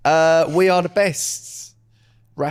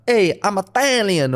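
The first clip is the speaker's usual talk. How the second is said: higher, drawn-out Italian-American caricature; mock Italian-American; 4 semitones higher, slower than usual. Comic showing how Americans claim roots, mock Italian-American. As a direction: play up a proud Italian-American. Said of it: "they'll go like".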